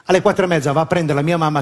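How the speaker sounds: ordinary delivery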